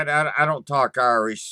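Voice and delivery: normal talking voice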